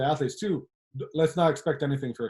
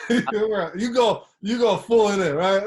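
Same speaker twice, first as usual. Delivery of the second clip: laughing; 6 semitones higher, 6 dB louder than usual. Real, not acted. Teasing friend about going all in.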